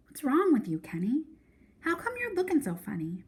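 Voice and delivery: put-on a puzzled young sister asking; worried little sister questioning her brother; little sister Joey. A narrator's reading. Joey voiced in the story.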